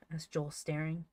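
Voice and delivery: ordinary delivery, nothing marked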